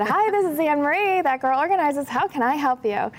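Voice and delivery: high, bright and cheerful phone greeting; happiest late-night phone voice. Retold or imitated. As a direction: answer the phone like a bubbly receptionist hiding the late hour. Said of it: "in my happiest ten thirty at night voice".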